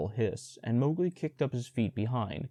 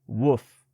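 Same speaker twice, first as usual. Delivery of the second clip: loud gruff bark of a bear; angry bear woof; 7 dB louder than usual. Acted. Bear snaps at the boy on the panther's back, a loud woof.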